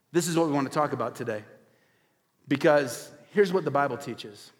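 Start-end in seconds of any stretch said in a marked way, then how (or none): none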